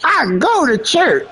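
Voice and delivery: high, slow mocking singsong; exaggerated squeaky-high parody of a boy. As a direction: mimic him in a drawn-out high voice. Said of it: "That boy can say".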